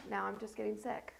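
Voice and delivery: usual speaking voice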